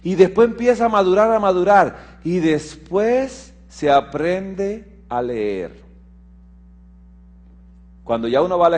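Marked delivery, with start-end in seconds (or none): 0.0-5.8 rising, insistent sermon voice with the pitch climbing high; preaching. Acted. Pastor urging the church to listen to God, insistent preaching. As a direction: preach it, driving each command home.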